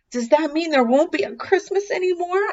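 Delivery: high, fast, anxious little fox; worried fox. Acted. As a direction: blurt it out in alarm. Alarmed fox asks.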